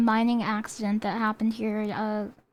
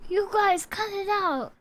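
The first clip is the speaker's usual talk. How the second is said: high scolding tone; 9 semitones higher than usual. Retold or imitated. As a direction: scold them sharply. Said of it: "I'm not like".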